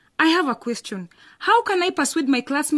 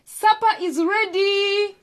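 calling out; 7 semitones higher, much slower than usual. Acted. Calling family to supper.

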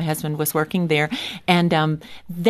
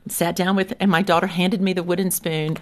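ordinary talk, nothing marked

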